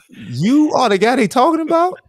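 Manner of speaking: high, loud incredulous question; can't believe this is him; stunned disbelief. Retold or imitated. Stunned on meeting the tall kid.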